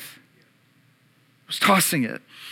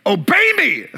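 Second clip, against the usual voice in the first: mock command; 7 semitones higher than usual. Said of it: "I'm like".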